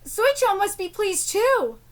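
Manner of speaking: ordinary talk, nothing marked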